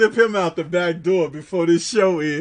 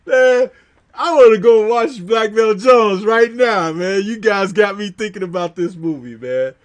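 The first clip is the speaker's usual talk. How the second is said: usual speaking voice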